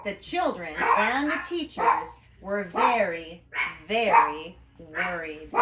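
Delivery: storyteller ramping up the suspense; dramatic narration. A narrator's reading. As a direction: stretch out the worry for effect. Narrator builds the worry.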